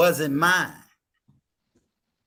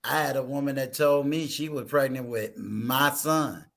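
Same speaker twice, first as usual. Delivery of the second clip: ordinary delivery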